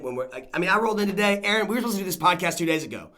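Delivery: ordinary talk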